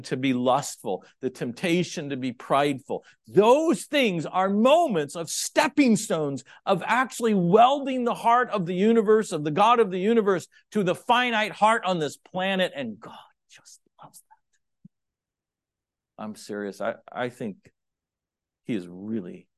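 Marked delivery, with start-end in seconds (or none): 3.3-12.7 loud, high preaching voice; full-force preaching. Acted. Preacher to the congregation, loud high preaching. 12.8-16.2 sudden hushed whisper. Acted. Preacher to the congregation, hushed whisper.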